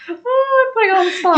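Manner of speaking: very high, mock-distressed whine; high-pitched fluster. Real, not acted. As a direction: wail lightly at being put on the spot. Flustered reading live.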